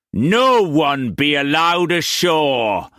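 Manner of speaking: captain shouting orders to the crew. A narrator's reading. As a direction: shout it across the whole ship. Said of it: "Brock shouted".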